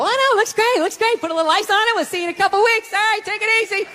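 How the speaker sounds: high, falsely upbeat praise; cheerfully lie and send them on their way; lying reassurance. Acted. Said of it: "They're just lying to people".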